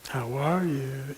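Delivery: very slow, softened greeting; imitated hello, stretched and hushed; certain tone of voice. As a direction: echo the greeting, drawing it out quietly. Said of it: "had a certain tone of voice".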